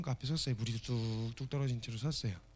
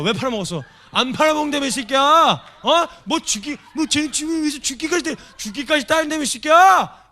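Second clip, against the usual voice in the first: shrieking high, loud hammed-up scolding; mock furious yell; 12 semitones higher, 15 dB louder than usual. Jesus yelling at Peter for betraying him. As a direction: scream the accusation, half laughing.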